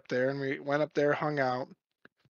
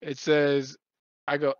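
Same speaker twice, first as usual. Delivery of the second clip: usual speaking voice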